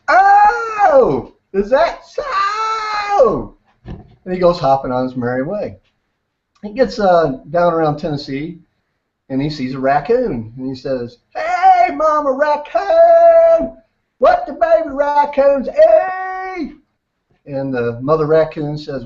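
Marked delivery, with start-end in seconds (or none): 0.1-3.5 very high, stretched-out wail; big-mouth frog. Acted. Frog answering the mama deer, big-mouth voice. 11.3-16.8 high, slow, hollering frog; big-mouth frog. Acted. Baby frog calling out to a mama raccoon, big-mouth voice.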